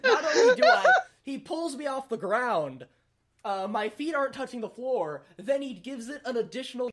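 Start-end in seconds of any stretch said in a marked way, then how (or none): 0.0-2.9 telling it through laughter, higher pitch; laughter breaking into the telling; laughing. Real, not acted. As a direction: crack up while trying to finish the story. Laughing at own story's climax.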